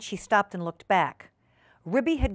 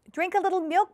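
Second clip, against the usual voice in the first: high coaxing voice; 10 semitones higher than usual. Acted. Ribby coaxing sick Duchess.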